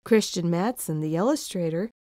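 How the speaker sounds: ordinary delivery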